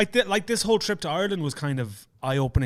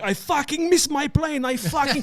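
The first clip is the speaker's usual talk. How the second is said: imitated Russian yelling; 14 semitones higher than usual. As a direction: mimic a Russian man yelling at the airport. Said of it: "there's a Russian guy yelling".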